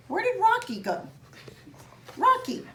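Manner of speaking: high call searching for the dog. Real, not acted. Looking for and calling her dog.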